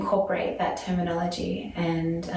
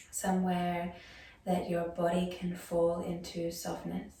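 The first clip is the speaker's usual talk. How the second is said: softer yoga nidra voice with long pauses; guided meditation; 10 dB quieter than usual. Acted. Yoga teacher guiding students into breath and body awareness, soft meditation voice. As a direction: ease them gently into stillness, leaving space after each phrase.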